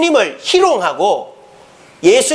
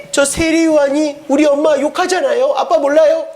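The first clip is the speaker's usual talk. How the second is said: high, whining daughter act; 살로메; 6 semitones higher than usual. Acted. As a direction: complain to daddy in a raised girlish voice. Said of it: "이 살로메가". (높은 목소리로 아빠에게 칭얼대는 딸)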